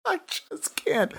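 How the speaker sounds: breathless whisper after laughing; helpless whispered giggle. Real, not acted. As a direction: barely get it out through the laugh. Laughing at the Bible quote.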